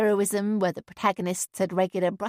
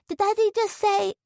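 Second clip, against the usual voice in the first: put-on high child's voice, shocked; a little boy gasping at his dad's swearing; small boy; 12 semitones higher than usual. A narrator's reading. Boy asks his mother about daddy's rude word, high child voice.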